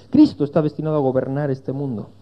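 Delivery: ordinary talk, nothing marked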